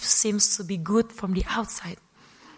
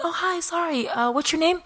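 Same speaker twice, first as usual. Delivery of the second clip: high-pitched, fake-casual small talk; put-on breezy politeness, pitched up; pretending to be cool; 8 semitones higher than usual. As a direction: feign not recognizing someone famous. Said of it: "I have to be cool".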